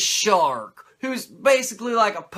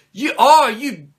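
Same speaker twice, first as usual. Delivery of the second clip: higher, louder sputtering mock scold; hammed-up flustered dad scolding; 4 semitones higher, 6 dB louder, much slower than usual. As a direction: splutter like a dad too mad to swear.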